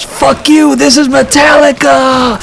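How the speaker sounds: very high, loud defiant shout; defiant fan shout. Retold or imitated. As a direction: scream it back at the cop with glee. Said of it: "and yells".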